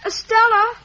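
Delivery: a loud, high summons; calling out. Acted. Calling Estella to the door.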